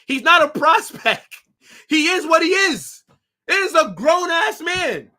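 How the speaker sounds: loud exasperated yelling. Real, not acted. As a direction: rant it at full volume. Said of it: "I'm just yelling in general".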